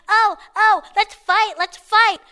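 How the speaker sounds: high, loud mocking challenge; hammed-up dumb kid goading; mock scrappy kid. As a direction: imitate a kid itching to fight. Voices the kid picking a fight.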